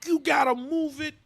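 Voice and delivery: high mock insisting; insisting athlete. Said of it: "He's like".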